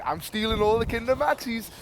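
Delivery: a retold shout at the shop assistant; mock shout. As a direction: cheekily shout it across the shop. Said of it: "and he'd shout".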